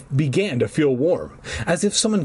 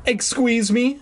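high incredulous question; cried out; 10 semitones higher than usual. A narrator's reading. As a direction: squeak it out in disbelief. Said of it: "Fergie cried".

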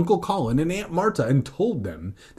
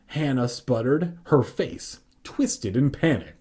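usual speaking voice

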